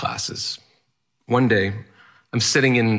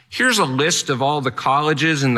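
guidance counselor; 5 semitones higher than usual. Retold or imitated.

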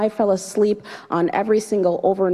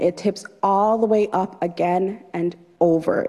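ordinary delivery, nothing marked

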